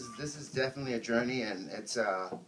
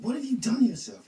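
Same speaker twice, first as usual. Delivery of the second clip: shocked doctor; 11 semitones higher, 6 dB louder than usual. Retold or imitated. Shocked doctor quoted in reading.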